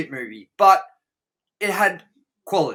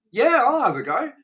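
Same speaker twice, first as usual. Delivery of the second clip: casual agreement; 9 semitones higher, 5 dB louder than usual. Pretend. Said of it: "And you were like".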